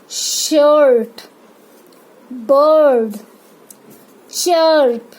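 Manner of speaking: शर्ट, बर्ड, चर्प with a long r-coloured er; er sound demonstration. Shown as a demonstration. Teacher models half-r sound, stretched er in शर्ट, बर्ड, चर्प. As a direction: draw out each er slowly, word by word. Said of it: "उसका sound निकलेगा".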